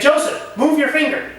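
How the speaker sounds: sharp high call of a name, then the order; commanding call. Retold or imitated. Teacher orders intern Joseph to move his finger, sharp high command. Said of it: "I said".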